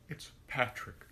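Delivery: тихо, почти шёпотом, сдавленно называет имя Патрик; шепчет имя себе под нос, смущённо; whispered name. Pretend.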